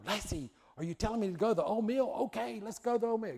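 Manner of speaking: hammed-up sitcom parent catching on; TV family member. Family answering Lassie in TV show.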